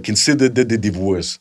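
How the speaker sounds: put-on Schwarzenegger's accent, smooth and unhurried; Arnold Schwarzenegger impression. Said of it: "it's like".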